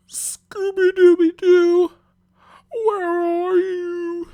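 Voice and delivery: very high, slow cartoon holler; drawn-out squealing yell; calling out. A narrator's reading. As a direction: call the dog in a long falsetto shout. Shaggy calls out for the dog.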